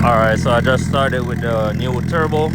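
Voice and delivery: talking loudly over a revving engine. Real, not acted. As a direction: raise your voice over engine noise.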